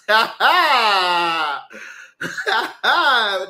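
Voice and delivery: long, loud burst of laughter. Real, not acted. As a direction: crack up loudly at your own remark. Cracks up at own slur remark.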